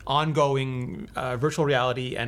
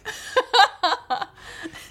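sudden high-pitched laugh; high burst of laughter. Real, not acted. Laughing at the silly spell.

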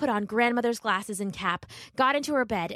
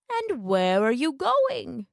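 slow, sly wolf voice; 5 semitones higher, slower than usual. Acted. Wolf coaxing Little Red on the path, slow and sly.

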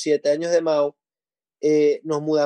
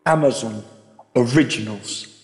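British accent; 5 semitones lower than usual. Shown as a demonstration. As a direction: say it like a grand British film announcer. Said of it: "Yo podría decirlo también como en acento británico".

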